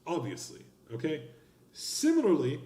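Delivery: usual speaking voice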